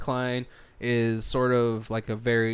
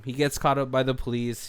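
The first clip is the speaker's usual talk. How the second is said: ordinary delivery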